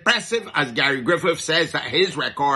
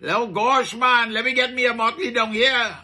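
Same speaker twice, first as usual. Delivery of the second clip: put-on Caribbean accent; 5 semitones higher than usual. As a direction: playfully imitate another island's Caribbean accent, pitched up. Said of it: "that little accent thing that I put on there".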